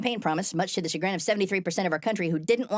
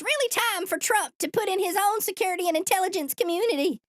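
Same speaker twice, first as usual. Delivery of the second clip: put-on Southern accent; 11 semitones higher than usual. Host impersonating a Republican spokeswoman, exaggerated Southern accent. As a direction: put on a much higher Southern voice. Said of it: "doesn't actually have a Southern accent".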